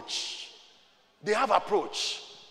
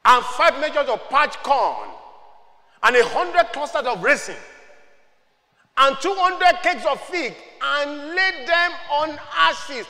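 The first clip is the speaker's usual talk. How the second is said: loud, slow scripture declamation building higher; emphatic raised pulpit voice; forceful preaching; 8 semitones higher, 7 dB louder than usual. Real, not acted. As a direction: drive each item home to the congregation.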